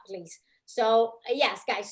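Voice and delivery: ordinary talk, nothing marked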